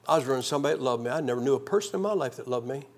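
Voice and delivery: ordinary talk, nothing marked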